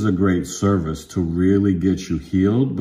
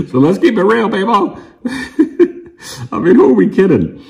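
laughing; 12 semitones higher, 5 dB louder than usual. Real, not acted. Laughing high aside to viewers.

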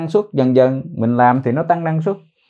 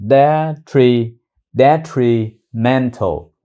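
slow careful demo; much slower than usual. Shown as a demonstration. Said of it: "slow syllable demo".

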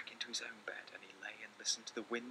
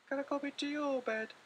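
much higher pleading little voice; little mouse asking; 5 dB louder than usual. Acted. Little Mouse asking Big Mouse to share his bed, high small plea. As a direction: beg like a tiny mouse. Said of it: "asked Little Mouse".

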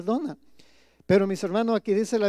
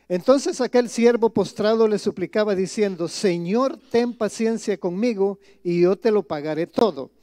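scripture reading; 6 dB louder than usual. Acted. Pastor reading the parable aloud to his congregation, solemn and loud. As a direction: proclaim the verse to the congregation.

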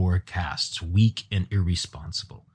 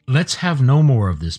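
loud, higher, stern rebuke; angry; 5 semitones higher, 7 dB louder than usual. Acted. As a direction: put your foot down angrily. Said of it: "Pid said angrily".

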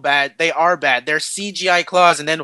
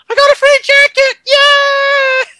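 much higher, loud shouted whoop; mock gleeful whoop; 7 dB louder, slower than usual. Wolverine riding off from the barn, screaming with joy. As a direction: yell it like a cartoon joyride. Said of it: "going like".

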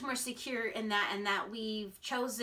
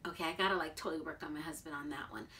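usual speaking voice

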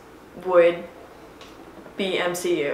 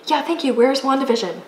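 higher and louder, demanding; getting more and more impatient; impatient excitement; 6 semitones higher, 4 dB louder, faster than usual. Real, not acted. The show isn't out yet.

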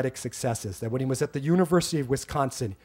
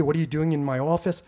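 ordinary delivery

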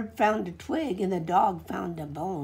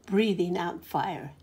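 ordinary delivery, nothing marked

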